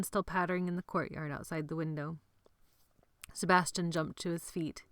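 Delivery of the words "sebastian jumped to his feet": ordinary talk, nothing marked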